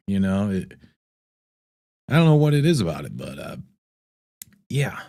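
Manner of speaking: normal talking voice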